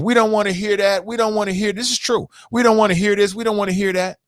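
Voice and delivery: mocking, high, brushing-off voice; high mock dismissal of the warnings; dismissive officials. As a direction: mimic officials who won't listen. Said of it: "on some anti-Clinton everything".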